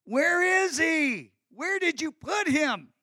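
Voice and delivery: high, slow, drawn-out plea; pained pleading. Acted. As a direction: cry out in Mary's anguish, stretching the words. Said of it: "Listen to her pain".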